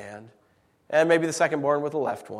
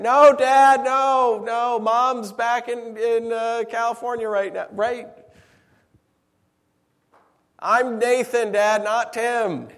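higher, louder coaxing of a confused dad; patiently herd a wandering father, voice raised; loud pleading son; 8 semitones higher, 6 dB louder, slower than usual. Retold or imitated.

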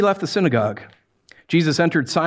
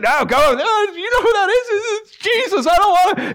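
much higher, louder whimpering refusal; whining scared minion; 3 dB louder than usual. Pretend. Minion begging Satan not to face Jesus, panicky high whine. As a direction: beg not to go.

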